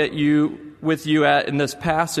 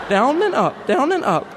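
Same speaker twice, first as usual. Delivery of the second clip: much higher, louder singsong mimicry; a boy throwing the chant back at his sister; mocking little boy; 11 semitones higher, 3 dB louder than usual.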